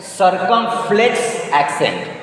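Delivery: "circumflex accent" slowly as "sur-kum-flex ak-sent"; English "circumflex accent" with a sounded final t; English pronunciation. Shown as a demonstration. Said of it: "In English".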